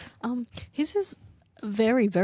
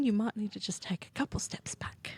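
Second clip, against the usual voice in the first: hushed, teasing whisper about a short teammate; conspiratorial whisper; 9 dB quieter than usual. Acted. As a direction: whisper it as a sly aside.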